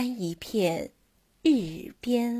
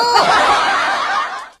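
playful laugh; 10 semitones higher, 5 dB louder than usual. Acted. Playful laugh before story.

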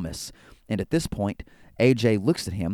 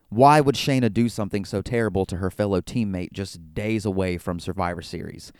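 usual speaking voice

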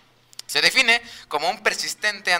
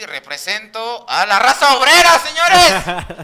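proud shout; 6 semitones higher, 9 dB louder, slower than usual. Acted. Proud proclamation to the room.

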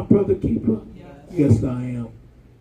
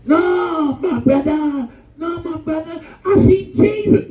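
high, sing-song holy act; high and syrupy, faking holiness; hammed-up pious churchgoer, pitched high; mock pious voice; 12 semitones higher than usual. Pious flirt quoted, voice up high.

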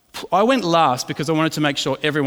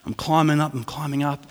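usual speaking voice

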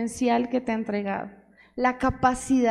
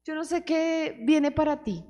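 normal talking voice

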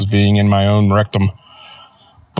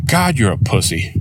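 flat, cold deadpan jab at the husband. Retold or imitated. Wife mocking crying husband, mild deadpan. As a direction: say it with no warmth at all. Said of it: "totally deadpan says".

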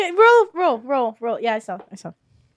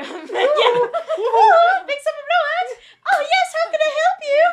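high cheery salesman voice; 14 semitones higher, slower than usual. Acted. GM voices the guard.

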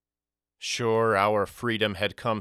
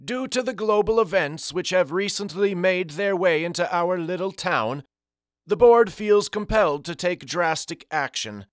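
put-on high, airy old man making a grave announcement; solemn, lofty old gentleman voice; Olaf Kirk; 8 semitones higher, 3 dB louder than usual. A narrator's reading. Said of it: "Olaf Kirk went on".

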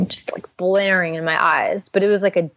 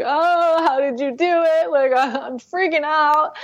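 higher pitched panicked plea, close to tears; retold crying plea; 8 semitones higher than usual. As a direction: plead for help as if in tears. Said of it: "I'm crying and I'm like".

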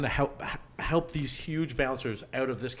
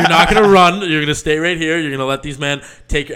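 loud, raised-pitch telling-off; retold firm orders; 4 semitones higher, 13 dB louder than usual. Friend ordering injured man to stay, loudly insistent. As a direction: lay down the law, half laughing at first.

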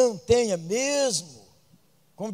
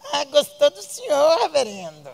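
high, sweetened hostess voice with a laugh; high, laughing mock hostess; 8 semitones higher, 6 dB louder than usual. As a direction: ham up a doting hostess. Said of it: "quoting the hostess".

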